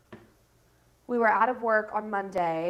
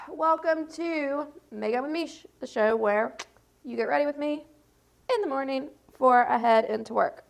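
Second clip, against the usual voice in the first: bright, loud, higher show intro; 4 semitones higher, 6 dB louder than usual. Acted. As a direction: announce the show like a host. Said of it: "That's gonna be such a cute intro".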